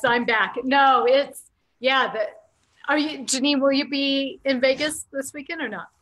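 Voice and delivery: ordinary talk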